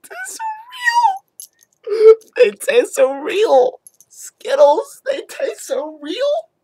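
high, loud, drawn-out fake wail; mock sobbing. Vlogger weeping over Skittles, mock sobbing. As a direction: cry it out in hammed-up tears.

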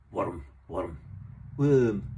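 a Scottish "worm" with a rolled r, the last one loud; Scottish accent. Shown as a demonstration. Showing viewers how he says worm in his Scottish accent. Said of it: "It's my Scottish accent".

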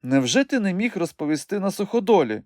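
mild exclamation in high woman's voice; high, indignant call without real volume; crying out. A narrator's reading. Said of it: "викрикнула вона".

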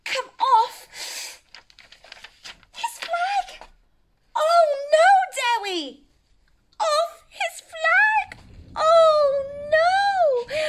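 high panicked wail, voice crying out; frightened cry. Acted. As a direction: cry out in alarm as the flag flies. Dragon swept off in the storm.

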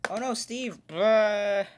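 high, loud dismayed shout. Real, not acted. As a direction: shout at the screen in despair. Character dies in game.

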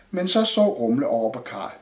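usual speaking voice

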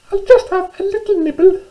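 very high, squeaky mouse voice; little mouse. Acted. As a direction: squeak it out like a tiny storybook mouse. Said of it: "she said to herself".

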